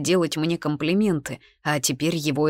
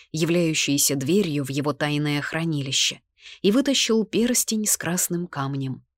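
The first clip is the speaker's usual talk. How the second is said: ordinary delivery, nothing marked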